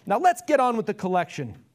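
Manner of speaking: high, loud impatient call; shout. A narrator's reading. Man in second row to auctioneer, shouted. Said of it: "The man sitting on the second row shouted".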